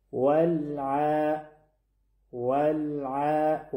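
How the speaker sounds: ولع chanted very slowly with a held, unbounced L; slow tajweed chant of ولع; still, unbounced lam. Shown as a demonstration. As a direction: hold the lam in ولع steady, then stretch the ayn vowel. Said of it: "اندکی هم تکان نباید بخورن".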